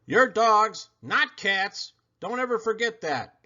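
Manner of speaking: high, firm mother dog voice. Retold or imitated. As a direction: lecture the puppies like their mama. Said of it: "Mama would say".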